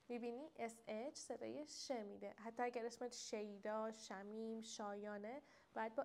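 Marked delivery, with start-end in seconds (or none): none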